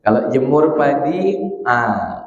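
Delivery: usual speaking voice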